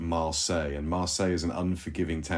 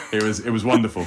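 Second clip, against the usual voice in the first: Boris Johnson voice; 2 semitones higher, 6 dB louder than usual. Acted. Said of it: "You sounded so Boris Johnson right then".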